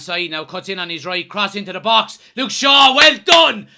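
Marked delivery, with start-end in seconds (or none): 2.4-3.7 loud, high cheer for a save; excited cheer. Real, not acted. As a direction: shout the praise. Cheering a defensive header.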